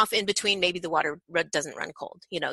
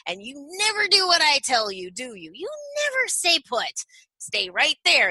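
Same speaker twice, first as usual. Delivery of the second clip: mock scolding; 7 semitones higher than usual. Shown as a demonstration. Playful scolding of toddler.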